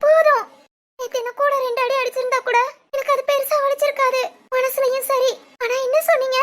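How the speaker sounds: loud, wounded reproach; hurt outburst. Acted. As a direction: cut him off and pour out the hurt forcefully. Hurt wife recalls his harsh words.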